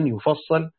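يفصل said yufassalu, ya with damma and a doubled sad with fatha; reading of Ibn Amir. Shown as a demonstration. Teacher shows students the Ibn Amir reading: يفصل as yufassalu. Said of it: "بضم الياء وفتح الصاد مشددا".

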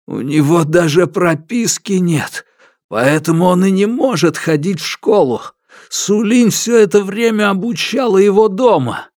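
distressed father. A narrator's reading. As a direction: lay out the despair.

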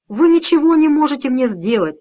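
high, loud defiant cry. A narrator's reading. Trapped boy cries out at his captor. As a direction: yell it in desperate defiance. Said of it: "вскричал мальчик".

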